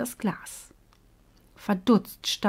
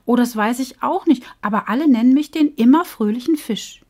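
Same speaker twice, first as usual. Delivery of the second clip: bright, lightly raised fish voice, chatty; a happy little fish chattering away; cheerful little fish; 4 semitones higher, 6 dB louder than usual. Acted. The cheerful fish chatting with Bo, lightly raised voice.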